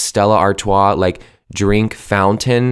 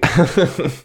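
short higher-pitched chuckle; amused little laugh to oneself; real laugh; 7 semitones higher than usual. Real, not acted. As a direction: laugh briefly at yourself. Laughs at own remark.

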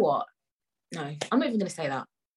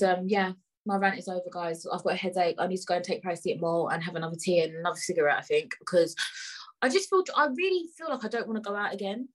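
ordinary talk

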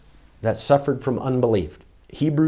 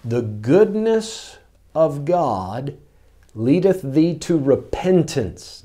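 slow, drawn-out, every word weighed; solemn, deliberate scripture reading; slow emphatic reading; 2 semitones higher, much slower than usual. Shown as a demonstration. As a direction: let each word of the verse sink in slowly. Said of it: "I want to really emphasize that".

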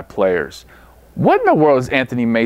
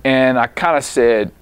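ordinary talk, nothing marked

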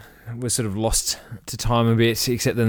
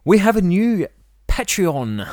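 excited; 8 semitones higher, 3 dB louder than usual. Real, not acted. Excited announcement.

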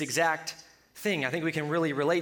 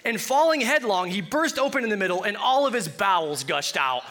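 mock-grand verse reading; 4 dB louder than usual. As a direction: proclaim the verse as if it were home decor. Imagined entryway sign, verse read out.

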